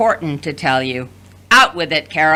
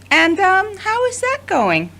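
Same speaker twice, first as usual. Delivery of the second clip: teasing; 11 semitones higher than usual. Acted. Mother teasing son.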